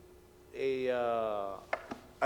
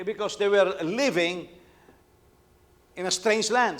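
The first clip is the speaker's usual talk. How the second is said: preaching; 3 semitones higher, 8 dB louder than usual. Acted. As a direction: drive the point home from the pulpit. Preaching builds to full force.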